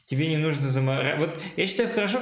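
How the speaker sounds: normal talking voice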